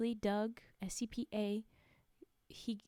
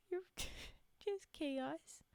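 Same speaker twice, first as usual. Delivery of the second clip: slow, high words broken by a laugh; real giggle, stretched out; 7 semitones higher, 6 dB quieter, much slower than usual. Real, not acted. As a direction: laugh through it at the chat's silliness. Laughing at chat nonsense.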